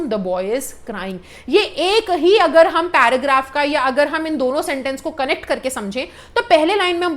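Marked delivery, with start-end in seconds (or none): 0.1-1.2 very slow, lower and softer; slow, low dictation. Shown as a demonstration. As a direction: let the sentence sink slowly and quietly. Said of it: "एक example देखिए".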